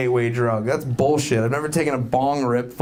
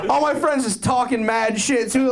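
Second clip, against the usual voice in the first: ordinary talk, nothing marked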